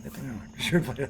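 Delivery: low, soft postgame courtesy; muted handshake praise. Acted. Coach consoling the man they just beat, soft and low. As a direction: toss off quiet consolation praise.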